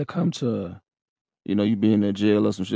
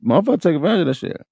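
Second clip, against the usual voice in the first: heated emphasis; 7 semitones higher, 5 dB louder, faster than usual. Real, not acted. Repeating point with heated emphasis.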